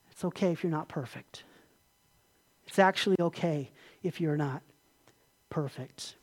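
soft, low consoling voice; hushed, tender comfort; gentle reassurance. Retold or imitated. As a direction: reassure a hurting friend quietly, with pauses. Jesus voiced gently consoling Peter.

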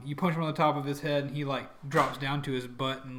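normal talking voice

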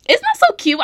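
high, pleased squeal; squeal, proud of yourself; delighted squeal. Real, not acted. Proud of her segment name.

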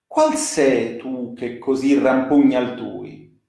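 declaiming Dante's verses with dramatic rise and fall; poem recitation. Acted. Reader performing Dante's Inferno canto for listeners, in a declaiming voice. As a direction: recite it like a stage reading of the Inferno.

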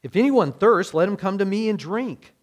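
loud, high proclaiming call: 'If anyone thirsts'; a preacher calling out loud and high to everyone; cried out. Acted. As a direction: cry it out to the whole crowd. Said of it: "Jesus stood up and cried out".

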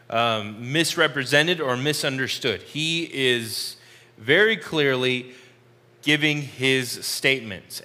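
usual speaking voice

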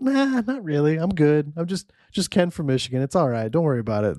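high, modest "nah, I'm good" imitation; imitated casual refusal. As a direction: play a guest waving off the offer. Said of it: "He's like".

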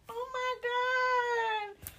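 imitating people fawning over a baby; gushing strangers. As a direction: coo it sky-high over a cute baby. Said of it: "People gonna be like".

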